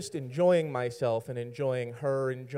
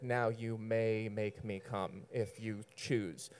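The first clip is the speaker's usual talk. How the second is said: slow, lofty princess, lower and softer; haughty princess; 3 semitones lower, 5 dB quieter, slower than usual. Retold or imitated. Teller imitates her granting him permission, haughty princess. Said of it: "this high princess attitude".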